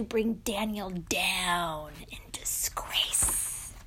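sinking into a whisper; hushed, conspiratorial storytelling; sinister hush. A narrator's reading. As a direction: let it drop away to an ominous whisper. Storyteller voicing a sinister plot.

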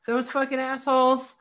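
put-on high, loud little kid swearing; a tiny kid cursing at the traffic; toddler cursing. Toddler in the car cursing at traffic for his mom, high and loud. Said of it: "And then he goes".

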